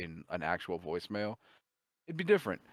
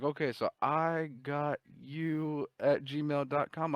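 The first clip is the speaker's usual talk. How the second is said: higher, drawn-out reading back; slow sounding-out; 6 semitones higher, slower than usual. Retold or imitated. Scammer reading the fake email aloud, slow sounding-out. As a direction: read it back slowly, word by word. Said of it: "and then he's like".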